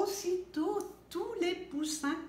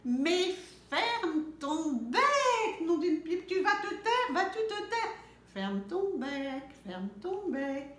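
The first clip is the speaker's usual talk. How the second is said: loud, slow, drawn-out scolding; whole farm roaring together; angry shouting; 5 semitones higher, 4 dB louder than usual. A narrator's reading. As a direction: bellow it at the chick. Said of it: "se mettent à crier".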